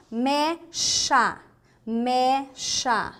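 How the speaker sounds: "mecha" split into open "mé" and hushed "sha"; SH sound. Shown as a demonstration. Teacher giving SH examples, syllable by syllable. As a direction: say "mecha" in two separate weighty syllables. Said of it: "mas tem o som de sh".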